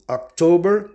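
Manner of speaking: "october" stressed on the middle syllable, ok-TOH-ber; English pronunciation. Shown as a demonstration. Teacher shows students an English exception, "october" said ok-TOH-ber. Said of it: "inclusive as suas exceções".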